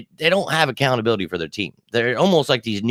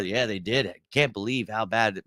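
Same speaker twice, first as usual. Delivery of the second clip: usual speaking voice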